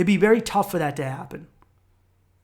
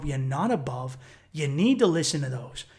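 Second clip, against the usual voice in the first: ordinary talk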